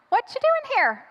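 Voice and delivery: high, falsely casual question; awkward stalling. Acted. Girlfriend stalls boyfriend's dad at door, falsely casual. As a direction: pitch it up, feigning nonchalance.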